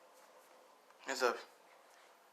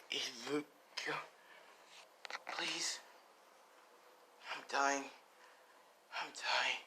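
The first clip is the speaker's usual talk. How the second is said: slow, faint, softer pleading; weak dying voice; 3 semitones higher, 6 dB quieter, slower than usual. Acted. Dying mother to son Izuku, slow and faint. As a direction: let the strength drain from each word.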